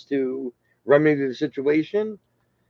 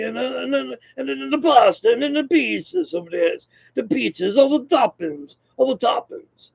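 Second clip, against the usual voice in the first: put-on poor Italian accent; 9 semitones higher than usual. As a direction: put on a bad, high-pitched Italian accent. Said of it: "That's a pretty poor accent".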